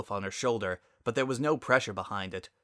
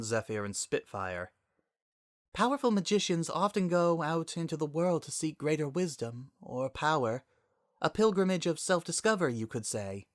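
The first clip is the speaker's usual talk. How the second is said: a hint of a higher, measured royal voice; lightly voiced Princess Celestia; 6 semitones higher than usual. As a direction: explain gravely and gently, lightly voiced. Celestia explains gently.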